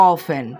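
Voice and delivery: অফেন said with no t sound; অফেন, not অফটেন, the t stays silent; teacher models অফেন without the t. Shown as a demonstration. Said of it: "এটা কিন্তু অফটেন হবে না".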